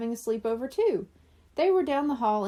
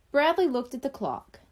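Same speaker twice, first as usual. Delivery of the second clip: plain reading voice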